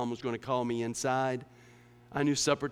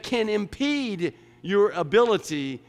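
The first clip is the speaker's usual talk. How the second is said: louder, driving sermon voice; full-force preaching; 7 semitones higher, 9 dB louder than usual. Real, not acted. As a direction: preach it hard to the congregation.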